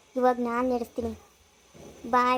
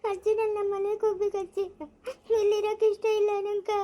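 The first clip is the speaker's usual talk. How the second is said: higher, tearful plea to go home; tearful pleading; 5 semitones higher than usual. Acted. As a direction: beg through tears, voice up high. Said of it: "ಯಾಕ್ ಅಳ್ತಾ ಇದ್ದೀಯಾ". (ಅಳುತ್ತಾ ಬೇಡಿಕೊಳ್ಳುವುದು)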